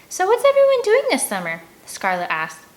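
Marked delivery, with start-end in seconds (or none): 0.1-1.6 put-on bright high girlish question; high, louder girl voice asking around; girl's voice. A narrator's reading. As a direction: lift into a cheerful girl asking the group. Scarlet voiced by narrator.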